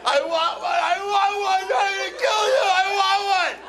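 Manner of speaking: high, loud bratty demanding; mock screaming kid. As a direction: shriek like a child throwing a tantrum. Mimics kids in the toy store.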